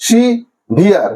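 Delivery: Severe in two slow beats; syllable by syllable. Shown as a demonstration. Teacher repeating the division, severe slowly broken in two. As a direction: pause between সি and ভিয়ার in severe. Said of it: "কিভাবে তোমরা উচ্চারণ করবে syllabic ডিভিশনে".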